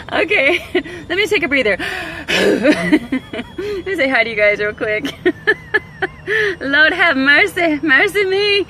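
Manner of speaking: breathless, giggling asides; laughing through words. Real, not acted. Catching her breath with viewers, laughing. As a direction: talk while still cracking up.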